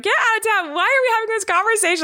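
very high mock freak-out. Imitating husband reacting to son's sibling wish, freaking out. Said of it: "Eric's, like, freaking out".

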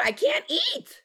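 whiny complaint. Real, not acted. Sick friend whining to the group about eating. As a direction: stretch it out in a sick whine.

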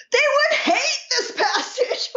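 high, slow, half-crying quote of a missionary; a touch of mock wailing, slowed right down; missionary's mock lament. Former missionary quoting men's reaction, high mock wail.

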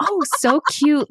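high, drawn-out cooing; gushing delight. Retold or imitated. Guest recalling her naive thought at dinner, gushing delight. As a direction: coo over something adorable. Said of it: "in my head, I was like".

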